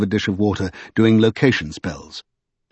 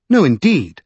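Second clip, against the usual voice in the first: mildly indignant, higher refusal; indignant cry; 4 semitones higher, 4 dB louder than usual. A narrator's reading. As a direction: cut him off with indignation. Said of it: "she cried, all indignation".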